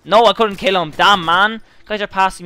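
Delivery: real frustration, high and loud; frustrated outburst. Real, not acted. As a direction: cry out at the miss. Failed to kill an enemy.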